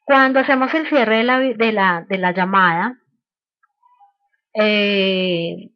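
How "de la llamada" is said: ordinary delivery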